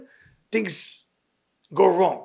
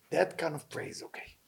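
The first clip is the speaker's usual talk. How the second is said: whisper; 2 semitones lower, 11 dB quieter than usual. Real, not acted. Soft aside about prayer.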